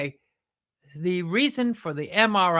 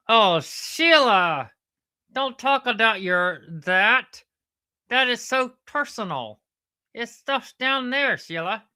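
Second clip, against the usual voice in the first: higher-pitched sidekick voice, drawn-out words; scandalized sidekick, protesting; 6 semitones higher, 4 dB louder than usual. Acted. Sidekick begging Sheila not to get personal, scandalized. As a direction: object as the prudish sidekick.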